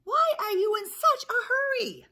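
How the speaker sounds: very high, loud duck squawk. Acted. Lucky Ducky bumped by the runners, high squawk. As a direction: squawk the question shrilly, like a duck. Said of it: "he squawked".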